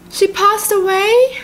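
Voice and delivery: high, slow rising question; stunned high-pitched echo; shocked disbelief. Real, not acted. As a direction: repeat it incredulously, pitch climbing. Shocked repeat of the death news.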